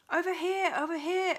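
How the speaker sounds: calling advice. A narrator's reading. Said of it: "advised the voice".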